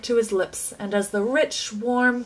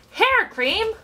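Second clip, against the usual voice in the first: much higher questioning rise; incredulous cry; 11 semitones higher than usual. A narrator's reading. Boy mocking the storeroom sign, incredulous. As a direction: scoff at the very idea. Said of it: "cried Mike T V".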